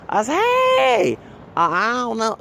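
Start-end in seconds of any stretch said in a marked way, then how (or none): 0.3-1.2 very high, loud, drawn-out hey; startled exclamation. Retold or imitated. Retelling a shocked reaction to the new accent, startled exclamation. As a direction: shoot way up in pitch, mock astonished.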